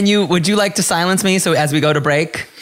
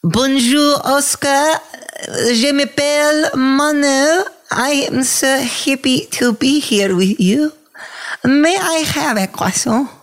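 high, slow put-on French accent; 9 semitones higher, slower than usual. Asked by a cohost, a silly high French accent. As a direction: ham up a breathy French accent for a friend. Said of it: "Can you give us a French accent?".

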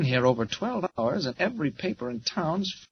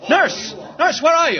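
urgent calling; 10 semitones higher, 7 dB louder than usual. Acted. Reporter calls for the nurse.

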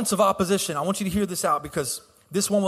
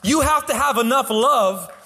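higher, louder, drawn-out preacher's cry; preaching at full force; 7 semitones higher, 3 dB louder than usual. Acted. Pastor urging the church to seek God, impassioned preaching. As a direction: lift the congregation with a rising plea.

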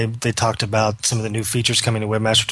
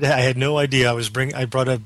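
ordinary talk, nothing marked

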